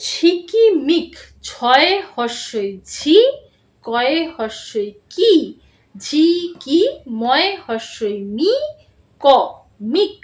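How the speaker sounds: each letter drawn out slowly, then blended; spelling out slowly. Shown as a demonstration. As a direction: spell it out for young pupils. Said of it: "আমি কিভাবে বলছি".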